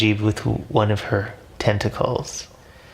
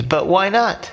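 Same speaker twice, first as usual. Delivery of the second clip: pleading; 9 semitones higher than usual. A narrator's reading. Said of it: "Majib pleads".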